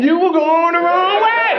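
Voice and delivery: high prissy mimicry of the clerk; mock her in a high voice; mimicking the clerk. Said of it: "This bitch said".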